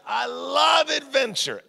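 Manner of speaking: "I love adventure" with "love" pitched high and drawn out slowly; a slow, high, rising burst of enthusiasm on "I love adventure"; excited exclaim. Shown as a demonstration. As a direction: gush that you "love adventure", stretching "love".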